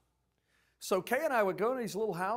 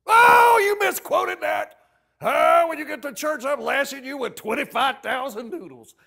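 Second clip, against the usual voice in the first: very loud, high outraged scolding; mock rant; 10 semitones higher, 17 dB louder than usual. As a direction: rant with hammed-up outrage, drawing out the "Oh". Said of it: "I don't go on some rant".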